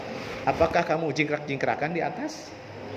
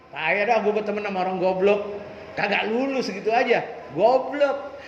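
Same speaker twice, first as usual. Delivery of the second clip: scornful taunt; 3 semitones higher than usual. Retold or imitated. Friend mocking continues.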